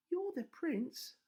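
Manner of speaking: put-on high, slow girlish princess voice; a young princess, pitched high and slow; high girl's voice, drawn out; Rapunzel's voice. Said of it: "said Rapunzel".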